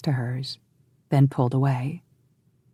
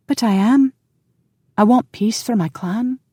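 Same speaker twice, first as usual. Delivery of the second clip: woman's voice; 9 semitones higher, 3 dB louder than usual. A narrator's reading. Heroine protesting.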